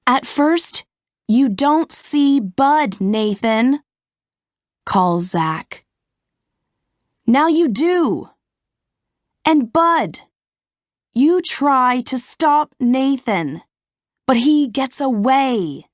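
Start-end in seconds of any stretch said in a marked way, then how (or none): 0.1-3.8 raised, higher director calling across the set. Acted. Director Zach calls to stand-ins. 7.3-15.9 raised, higher director calling across the set. Acted. Director Zach directs the stunt.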